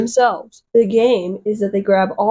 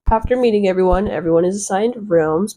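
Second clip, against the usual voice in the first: ordinary talk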